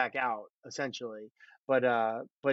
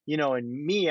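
ordinary talk, nothing marked

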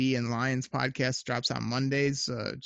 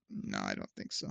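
deadpan dismissal; 10 dB quieter than usual. Retold or imitated. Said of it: "it was just kinda like".

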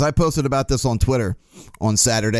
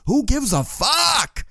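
drawn-out, high-pitched exasperated cry; exasperated outburst; 11 semitones higher, much slower than usual. Real, not acted. Exasperated retort to complaining fans.

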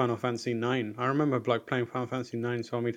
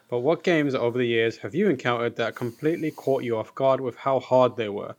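ordinary talk, nothing marked

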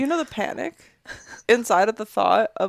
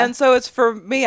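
normal talking voice

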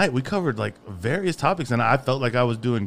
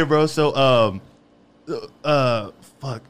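ordinary talk, nothing marked

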